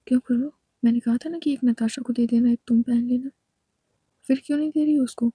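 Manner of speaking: fast, loud reprimand; scolding. Acted. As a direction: tell her off for disobeying. Ishani scolds Khushbu.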